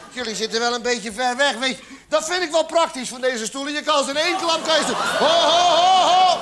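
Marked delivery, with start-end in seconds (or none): 4.9-6.4 alarmed ho ho. Acted. Beanbag balls spill out.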